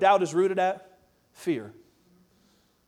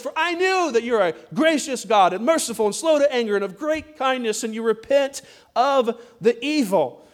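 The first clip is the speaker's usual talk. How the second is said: louder verse reading, slowing at the close; scripture reading; 3 semitones higher, 4 dB louder than usual. Acted. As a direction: read Jonah's prayer out to the church. Reads verse two aloud.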